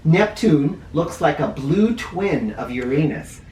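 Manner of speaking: usual speaking voice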